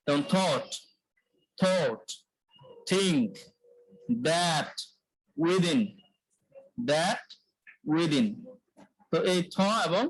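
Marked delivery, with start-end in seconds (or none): 0.3-8.3 unvoiced TH in থট and থিংক, voiced in দ্যাট and উইদিন; careful থট, থিংক, দ্যাট, উইদিন as examples; demonstrating TH words. Shown as a demonstration. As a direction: model each TH word separately, with pauses. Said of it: "একই জায়গাতে উচ্চারণ হবে".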